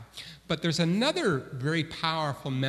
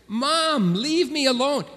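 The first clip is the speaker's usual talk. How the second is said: put-on higher, louder whine of a grumpy child; whiny kid; 10 semitones higher, 7 dB louder than usual. Boy whining at his mom to leave him alone. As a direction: complain like a kid dragged out of bed.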